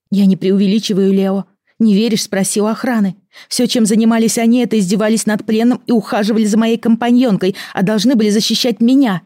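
loud, high, heated insistence; angry insistence. Acted. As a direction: press your point in anger. Amy keeps arguing angrily. (гневное настаивание)

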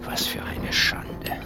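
ashamed whisper. Acted. Bound master whispering his shame as slaves watch. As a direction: mutter your shame to yourself.

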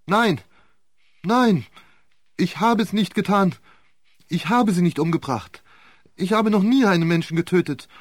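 high, loud, frantic denials; on the edge of collapse; accused man close to a nervous breakdown; near breakdown. A narrator's reading. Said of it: "Einem Nervenzusammenbruch nahe".

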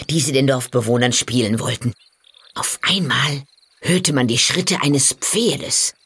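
ordinary delivery

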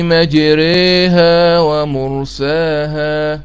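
مجريها slow chanted recitation, the ra vowel leaning toward e as majreha; recited with imala. Shown as a demonstration. Teacher recites the verse to students, slow melodic chant. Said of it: "هذه إمالة".

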